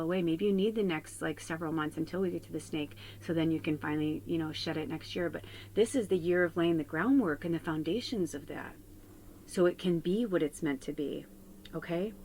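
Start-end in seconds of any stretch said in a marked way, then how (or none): none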